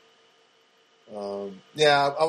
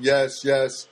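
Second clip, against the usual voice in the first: slow, louder mock-devoted agreement; a hammed-up slave vowing obedience to his master; mock servile; 4 dB louder than usual. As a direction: grovel to the bank in sarcastic surrender. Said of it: "And I started going".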